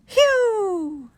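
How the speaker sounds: high falling whistled "whew"; mystery bird call. Acted. Narrator imitating the strange bird call.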